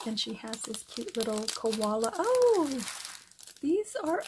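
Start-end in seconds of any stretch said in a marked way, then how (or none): 2.2-4.2 surprised squeal. Real, not acted. Surprised by new gift.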